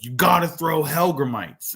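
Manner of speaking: high, drawn-out mock demand; retold angry demand. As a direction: imitate an agitated stranger insisting. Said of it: "Like, he gets angry".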